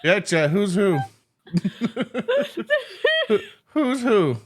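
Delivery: laughing teasing question. Real, not acted. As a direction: tease through laughter.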